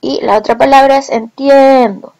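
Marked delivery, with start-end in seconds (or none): 1.1-2.1 "entiendo" with the stressed e held long; stressed vowel. Shown as a demonstration. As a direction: stretch "-tien-" in "entiendo". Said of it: "La última vocal acentuada es la E".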